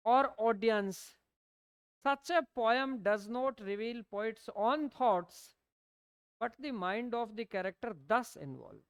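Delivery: slow, even note-dictation pace. Acted. Teacher dictating notes to students, slow and deliberate. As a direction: read it out word by word so students can write.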